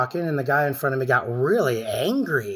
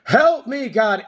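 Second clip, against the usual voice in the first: screaming for help; 10 semitones higher, much slower than usual. Retold or imitated. Man on the restaurant floor screaming to God for help. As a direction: wail it out like you're being beaten. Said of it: "And I started screaming".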